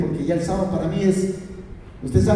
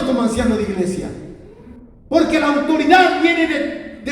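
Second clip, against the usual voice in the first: pleading prayer; 7 semitones higher than usual. Retold or imitated. Preacher retells pleading with the Lord, high impassioned prayer. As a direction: cry out to God, stretching the key words. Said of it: "yo decía entre mí".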